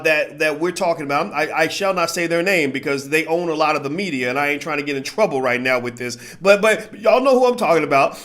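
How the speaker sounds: normal talking voice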